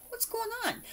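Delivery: high, lightly voiced puzzled little dog; pug's voice. Voicing a pug sticker, a high puzzled little dog. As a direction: voice the confused pug. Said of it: "He's like".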